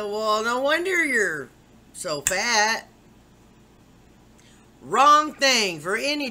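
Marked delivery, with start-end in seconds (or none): 0.0-2.9 higher, louder snide doctor voice; mocking the doctor. Mimicking the doctor's insult to her patient, snide and louder. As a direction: sneer it like the doctor did. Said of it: "here's what she said that pissed me off".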